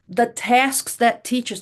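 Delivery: ordinary delivery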